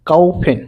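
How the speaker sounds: "কাউফেন" with au as in "ow"; model pronunciation. Shown as a demonstration. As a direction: say "কাউফেন" carefully, ow in the first syllable. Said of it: "আউ এর মতন উচ্চারণ হবে".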